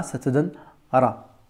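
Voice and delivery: "ra" with rolled R, slow and careful; teacher sounding out "ra"; slow demo ra. Shown as a demonstration. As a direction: spell out the sound of "ra". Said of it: "R roulé plus le A".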